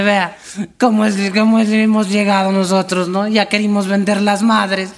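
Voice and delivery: put-on high-pitched weepy drunk lament; mock tearful. Weepy drunk explains to his compadre, mock crying. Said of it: "se pone a llorar".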